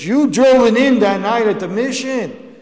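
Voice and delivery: a mildly excited quoted thank-you; excited gratitude. Retold or imitated. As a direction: gush it out like a thankful woman. Grateful woman recounting her mother's conversion.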